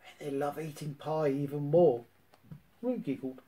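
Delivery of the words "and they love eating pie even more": ordinary delivery, nothing marked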